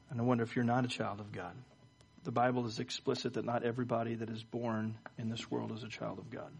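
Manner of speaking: soft, low-key pastoral invitation; gentle altar call. Real, not acted. Pastor addressing bowed heads about being saved, soft gentle invitation. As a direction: invite them quietly, pausing between phrases.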